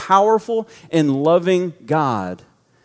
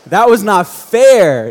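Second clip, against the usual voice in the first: loud, slow, hammed-up protest; hammed-up dad melting, loud and drawn out; mock protest; 4 semitones higher, 9 dB louder, slower than usual. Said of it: "And I'm like".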